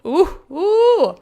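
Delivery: high, loud gushing squeal; excited squeal. Real, not acted. Streamer gushing about a crush, excited squeal.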